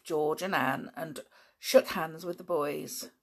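ordinary delivery, nothing marked

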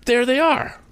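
high, excited cry of discovery; sudden high cry. A narrator's reading. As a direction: shout it out as he spots them. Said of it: "he uttered a cry".

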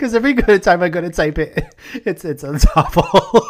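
high, loud laughing talk. Real, not acted. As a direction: push words out through laughter. Still laughing at the word.

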